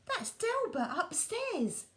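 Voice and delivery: put-on high, slow little-boy voice; a boy figuring it out; small boy musing; child's thought. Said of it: "thought Walter".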